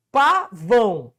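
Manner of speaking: Pavão slowly split into PA, VÃO; two long, detached syllables in Pavão. Shown as a demonstration. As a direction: sound out Pavão with a gap after PA. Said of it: "Qual que é aqui a sílaba tônica?".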